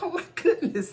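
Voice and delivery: high-pitched, laughing disbelief; retold giggly reaction to a cheeky insult; amused shock. Retells her amused shock at insult.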